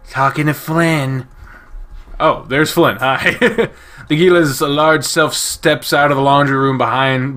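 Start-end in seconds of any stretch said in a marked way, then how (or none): 0.1-1.3 nerdy voice. Acted. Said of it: "the nerdiest voice ever".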